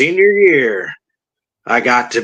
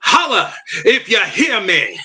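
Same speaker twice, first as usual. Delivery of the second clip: "Holla if you hear me" in a southern twang, pitched up high with a laugh; 9 semitones higher than usual. Shown as a demonstration. Guest obliging the host with a laughing southern-twang "Holla if you hear me". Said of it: "put a little southern twang on it".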